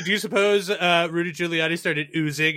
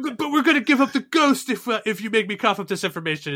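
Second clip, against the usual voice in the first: higher, louder pleading mock voice; whiny owner; 6 semitones higher, 3 dB louder than usual. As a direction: whine like a cornered coward. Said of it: "He's like".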